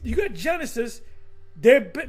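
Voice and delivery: ordinary talk, nothing marked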